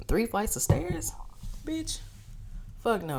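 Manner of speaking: incredulous complaint about the stairs; sassy exasperated pitch-up; outraged disbelief. Real, not acted.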